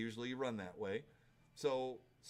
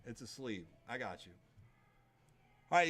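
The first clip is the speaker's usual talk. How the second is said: normal talking voice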